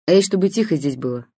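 резкий окрик на весь двор; прикрикни, чтобы все затихли. A narrator's reading. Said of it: "прикрикнула". (гадалка прикрикивает на соседей: громкий окрик)

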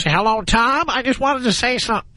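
mocking a bad prank voice to show how not to sound; mock prank caller. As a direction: lightly send up a clumsy prank caller. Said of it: "Gotta have a better voice than that".

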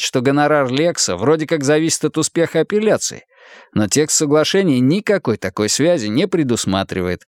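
plain reading voice

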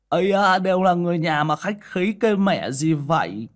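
high, loud, over-familiar banter; pushy comic sidekick. A narrator's reading. Tiểu Minh insists Chí Dũng take it, high chummy protest. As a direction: brush off the refusal in a high-pitched voice.

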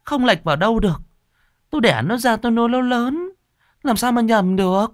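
very high, anguished mother's voice; distraught mother blurting out. A narrator's reading. Mother sure the sobbing is her own daughter's, distraught. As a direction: insist desperately, pitched right up. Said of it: "Bà Hấn liền buột miệng".

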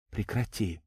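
hushed, softly begging; tearful plea. A narrator's reading. Cousin asking her to stop, softly tearful. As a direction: plead softly, close to tears. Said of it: "попросила Валентина, а у самой девушки на глаза наворачивались слёзы".

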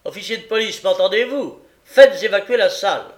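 very loud, high commanding shout; shouted order. Acted. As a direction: bellow at the officer. Magistrate orders court cleared.

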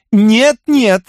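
high, prim and ceremonious. Acted. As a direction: decline with polite formality. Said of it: "церемонно сказала Энни".

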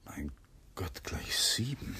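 soft, slow whisper; voiceless aside; whispered to himself. Acted. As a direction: mutter it to yourself in shock. Alone, noticing the time.

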